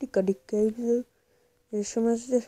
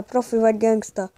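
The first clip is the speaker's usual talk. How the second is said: ordinary talk, nothing marked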